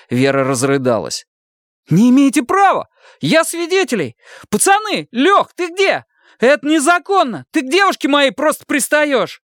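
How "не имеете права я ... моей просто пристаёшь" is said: desperate protest. Acted. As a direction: shout for help at the officer. Ilya protests, calls for friends.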